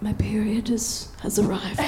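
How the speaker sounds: whispered dread; whispered reveal. Real, not acted. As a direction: drop to a whisper. Horrified reveal.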